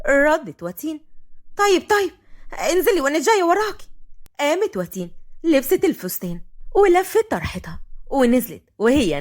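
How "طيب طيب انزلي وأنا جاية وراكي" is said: higher-pitched impatient call back; impatient reply. A narrator's reading. Watin to Zeinab at the door, impatient. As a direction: brush off the caller from bed.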